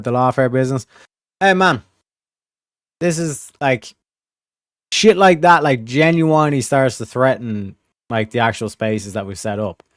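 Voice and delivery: ordinary talk, nothing marked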